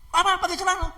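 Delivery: high, loud incredulous retort; acted indignant outburst; angry attacker. Man balking at the girl's order to dress, angry. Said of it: "sambil marah-marah".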